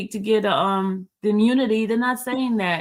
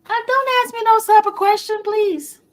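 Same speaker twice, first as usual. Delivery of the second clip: much higher, louder refusal; high pitched protest; 12 semitones higher, 4 dB louder than usual. Real, not acted. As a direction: squeal it out, refusing. Refuses question.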